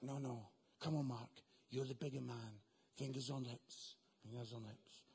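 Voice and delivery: very low, soft, calm God voice; God hushing. Retold or imitated. God tells Mark to stay quiet, very low and soft. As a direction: hush him down low like God.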